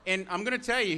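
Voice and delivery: usual speaking voice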